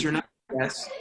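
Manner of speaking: normal talking voice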